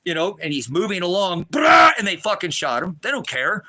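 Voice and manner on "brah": loud rolled burst of mouth-made gunshots; explosive vocal gun noise; imitated gunfire. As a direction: mimic a sudden volley of shots. Imitates gunfire that shot son.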